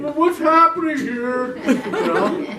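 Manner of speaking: high, loud, stretched-out mock wail; hammed-up tearful confusion; comic bewilderment. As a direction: blubber in baffled alarm. Mock bewilderment at sudden tears.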